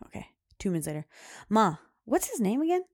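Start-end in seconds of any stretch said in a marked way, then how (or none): 1.5-2.8 put-on loud, high kid question, lifting at the end; imitating pestering daughter. Daughter asks mom two minutes later, mimicked loud and high. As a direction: mimic a child who forgot already.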